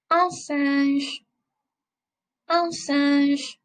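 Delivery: "singe" with a strongly nasal middle vowel; slow model of "Un singe", nasal "in"; nasal French vowel. Shown as a demonstration. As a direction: push the middle of "singe" through the nose. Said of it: "this middle part of the word is really nasal".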